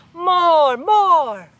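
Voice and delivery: loud crowd shout. A narrator's reading. Shoppers cheering for the show, loud shouting. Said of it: "voices shouting".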